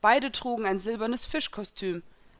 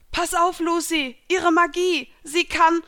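high, loud warning shout; urgent warning; 9 semitones higher, 8 dB louder than usual. A narrator's reading. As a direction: warn a friend fast before being cut off. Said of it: "setzte Gray an".

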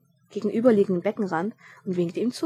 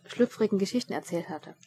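plain reading voice

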